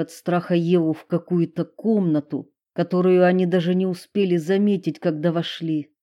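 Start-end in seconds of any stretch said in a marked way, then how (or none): none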